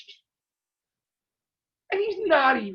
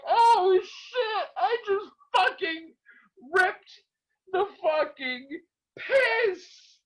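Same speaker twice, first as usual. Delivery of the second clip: high, near-laughing retold panic; half-laughing panic; 8 semitones higher, much slower than usual. Retelling his panicked realization.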